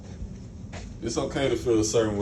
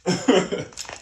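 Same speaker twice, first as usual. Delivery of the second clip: real laugh; 7 semitones higher than usual. Real, not acted. Laughing at his own imitation.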